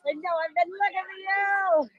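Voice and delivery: high, slow, sing-song cooing. Real, not acted. Greeting her little girlfriend, high sing-song cooing. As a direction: coo over her like a little one, high and drawn out.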